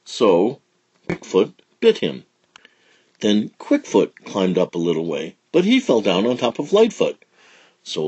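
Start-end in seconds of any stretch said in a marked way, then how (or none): none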